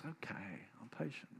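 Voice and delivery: hushed, mild self-calming; quiet patient resolve. Retold or imitated. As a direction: talk yourself into patience.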